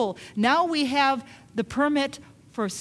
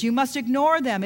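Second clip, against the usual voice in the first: ordinary talk, nothing marked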